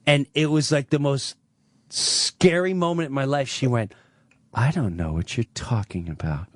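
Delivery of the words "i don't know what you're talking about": mother's cold denial. Retold or imitated. Mother denying abuse.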